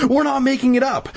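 high-pitched mild mock shout; angry shout. A narrator's reading. Danny to the doubting girls, mildly angry shout. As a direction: protest high, with a touch of anger. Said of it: "Danny shouted angrily".

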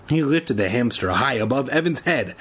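ordinary delivery

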